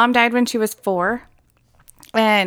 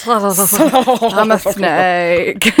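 slow, drawn-out sneaky snake voice; hissy snake voice; 3 semitones lower, much slower than usual. Pretend. Teasing co-host with the snake bit, a slow creepy hiss. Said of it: "How does that go again?".

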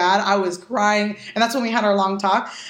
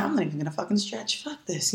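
sulky mutter; 4 semitones lower, 9 dB quieter than usual. Retold or imitated. Sulking on the way in.

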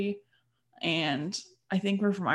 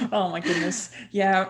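laughing loudly; bursting out laughing; 4 semitones higher, 7 dB louder than usual. Real, not acted. Laughing at friend's joke.

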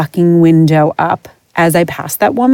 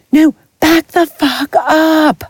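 high, slow, drawn-out yell; hammed-up angry yell, pitch way up; mock angry yell; 10 semitones higher, much slower than usual. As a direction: snap at a pushy owner to back off. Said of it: "I'm like".

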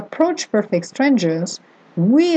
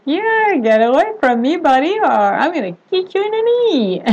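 high, mock-sweet warning; smiling brush-off; 7 semitones higher than usual. Shopper warning off a pushy stranger, smiling brush-off. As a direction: lift it high, a playful threat through a smile. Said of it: "And with a smile, like".

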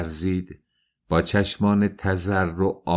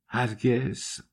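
hushed, softer "هرگز" with a slight rise; soft whispered never; 4 semitones higher, 5 dB quieter, much slower than usual. Acted. Dunya softly refuses Svidrigailov's love, hushed. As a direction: breathe "هرگز" almost under the breath. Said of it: "دختر زمزمه کرد".

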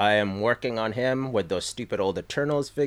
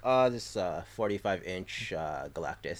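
casual understated; 4 semitones lower, 5 dB quieter than usual. Retold or imitated. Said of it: "He's like".

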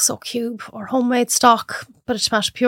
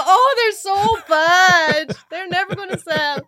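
forced laugh; 10 semitones higher, slower than usual. Retold or imitated. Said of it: "laugh and go".

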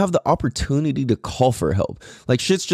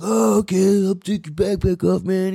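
put-on high, dopey drunk voice; 7 semitones higher than usual. Voicing drunk dude.

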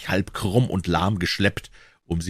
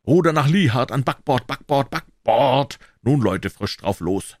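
ordinary delivery

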